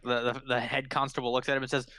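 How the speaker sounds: usual speaking voice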